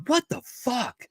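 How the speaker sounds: high incredulous exclaim; shocked by the fancy room. Retold or imitated.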